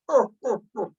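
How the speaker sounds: short teasing laugh at a friend; mocking laugh. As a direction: laugh at him like you just won. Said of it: "laughing at me like that".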